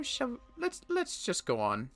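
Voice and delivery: ordinary talk, nothing marked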